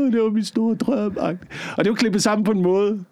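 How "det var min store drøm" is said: hammed-up high-pitched wail; faked breakdown. Contestant playing up a breakdown over a lost dream for the show, mock sobbing. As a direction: put on a tearful collapse, drawing out the words slowly. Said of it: "at jeg fik et sammenbrud".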